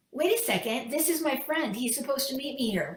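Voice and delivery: alarmed protest. Retold or imitated. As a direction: break in with bewildered protest. Businessman protests as friend is led away.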